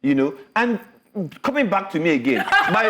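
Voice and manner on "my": high laughing burst. Real, not acted. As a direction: break into laughter. Laughing at own nudity talk.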